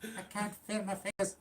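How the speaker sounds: high, slow pained put-on voice; whiny high voice. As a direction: whimper it in a feeble voice. Voicing injured wrestler.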